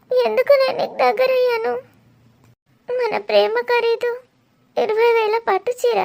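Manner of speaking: crying, breaking voice, pleading with an absent lover; tearful lament. Acted. Abandoned woman begs her lover Dheeru not to leave, crying. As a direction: let the sobs break through the words.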